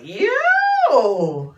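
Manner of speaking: loud, high, drawn-out yell; hammed-up indignant shout; mock outrage. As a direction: holler it in disbelief, stretched long. Acted outraged reaction to refusal.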